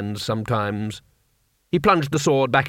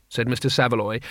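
usual speaking voice

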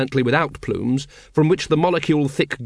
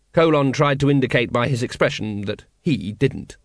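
ordinary delivery, nothing marked

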